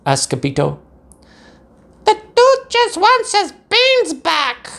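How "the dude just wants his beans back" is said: high, slow squeaky pup voice; Paquito voiced. A narrator's reading. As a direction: squeak it like a tiny dog. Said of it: "said Paquito Tito".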